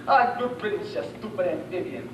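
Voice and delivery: normal talking voice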